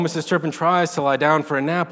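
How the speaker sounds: plain reading voice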